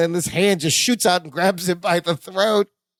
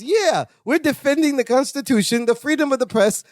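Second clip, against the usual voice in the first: higher-pitched, grandly earnest character quote; proud mock declaration; 8 semitones higher than usual. Cellmate defending the Constitution to hoodlums, proudly. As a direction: declare it proudly, stretching the last words.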